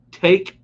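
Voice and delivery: puzzled read of the texter's Take; reading the typo aloud. Retold or imitated. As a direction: Take punched high and loud, read as written. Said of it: "Maybe you meant Tech".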